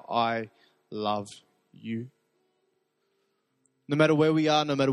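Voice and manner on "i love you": tender whisper-soft. Acted. Heart answering God, drawn out.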